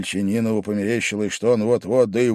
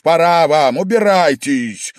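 loud, raised-pitch dismissal; angry shout; 6 semitones higher, 8 dB louder than usual. A narrator's reading. Host throwing his guest out, angry shout. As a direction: order him out angrily. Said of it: "опять рассердился и закричал Вельчининов".

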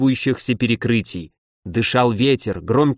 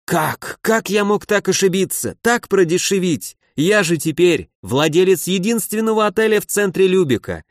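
higher-pitched, theatrical lament; 5 semitones higher than usual. A narrator's reading. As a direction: bewail your own blunder. Йохан причитает о своей потере. (причитая, с досадой)

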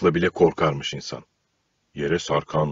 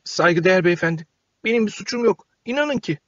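high, small pleading voice; timid puppet boy; 12 semitones higher than usual. A narrator's reading. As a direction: shrink and plead like a scared puppet. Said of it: "eğilip büzülerek".

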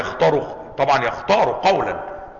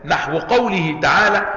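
ordinary talk, nothing marked

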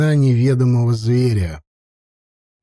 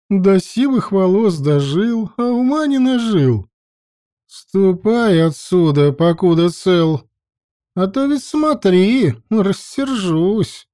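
scornful threat; 6 semitones higher, slower than usual. A narrator's reading. Priestess threatens old man.

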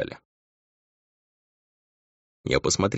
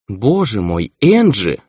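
raised, louder cry of dismay; appalled outburst; shocked exclamation; 4 semitones higher, 7 dB louder, slower than usual. A narrator's reading. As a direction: exclaim in shock, pitch leaping up. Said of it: "воскликнула она".

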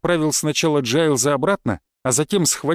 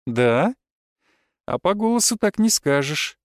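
higher, teasing disbelief; doubting goddess; 4 semitones higher than usual. A narrator's reading. Goddess doubts the hero is content, teasing. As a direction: question it, then tease in a higher voice.

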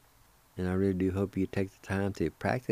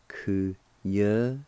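ordinary talk, nothing marked

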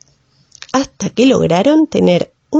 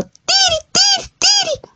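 shrill, very high bird cry of alarm; tero bird call. Acted. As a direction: squeal it like a startled lapwing. Tero bird warns Elena off.

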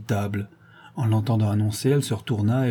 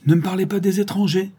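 much higher and louder, blurted out; sudden outburst; 10 semitones higher, 4 dB louder than usual. A narrator's reading. Old miller bursting out at the countess's tea guests, loud. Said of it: "s'écria tout à coup Mérikov".

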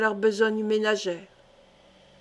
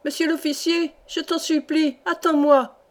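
higher, pleading call, running up out of breath; breathless calling; 5 semitones higher than usual. Acted. Old peasant woman runs to the gendarmes: higher breathless plea. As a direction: call after him, begging, still panting. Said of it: "appela une paysanne essoufflée".

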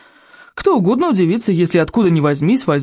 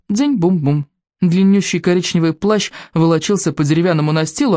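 ordinary delivery

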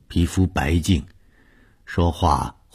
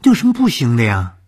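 meaningful, higher and louder rhetorical question; 8 semitones higher, 7 dB louder than usual. Acted. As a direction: brush off the doubt with a hint of hidden intent. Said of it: "意味深长地说". (意味深长地反问)

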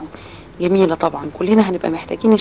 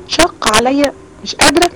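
higher, louder strained plea of giving up; struggling reader's complaint; 7 semitones higher, 5 dB louder than usual. Pretend. Teacher voicing a child who can't manage the recitation, strained. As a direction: sound worn out by the effort.

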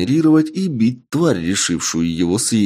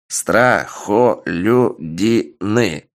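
"Страхолюдины" spelled out slowly, syllable by syllable, stretched long; 2 semitones higher, much slower than usual. Acted. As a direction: drag "Страхолюдины" out in separate syllables. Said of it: "громко произнёс эльф по слогам".

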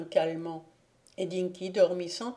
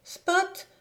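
much higher, ringing joyful cry; ecstatic cry; 11 semitones higher, 3 dB louder than usual. A narrator's reading. Dying boy greets his dog in ecstasy, high joyful cry. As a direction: cry the dog's name in rapture. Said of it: "cria Dinky dans une sorte d'extase".

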